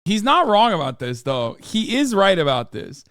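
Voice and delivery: ordinary delivery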